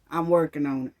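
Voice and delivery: sarcastic low brush-off; mock brush-off. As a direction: throw their excuse back lazily. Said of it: "you know what I'm gonna say".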